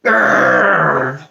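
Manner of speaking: low rolling dog growl; puppy growl. A narrator's reading. Puppy who hates being a doll, low growl. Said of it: "Lucky growled".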